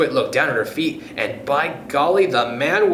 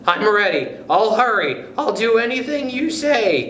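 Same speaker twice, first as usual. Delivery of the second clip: eager cry; 8 semitones higher than usual. Acted. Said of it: "cried Missus Twit".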